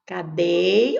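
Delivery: "Cadê" with its last syllable held and rising; lengthened stressed syllable. Shown as a demonstration. Teacher pointing out the tonic syllable, end of "Cadê" stretched. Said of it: "aquela sílaba que eu demoro mais".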